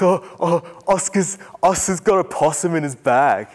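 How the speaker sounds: imitating a panicked grade nine boy; excited boy. Boy tells tutor about the possum, high and excited. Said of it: "He goes".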